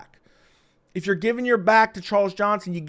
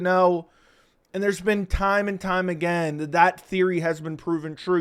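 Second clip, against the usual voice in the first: usual speaking voice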